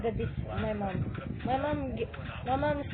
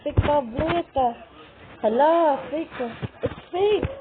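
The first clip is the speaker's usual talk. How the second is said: loud high shouts at the tablet; frantic yelling; 3 semitones higher, 7 dB louder than usual. Real, not acted. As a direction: yell at the device in panic.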